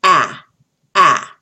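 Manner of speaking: "ah, ah" as an isolated short A, punched out; short A sound. Shown as a demonstration. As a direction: say the short A, "ah", twice, loudly. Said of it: "short A".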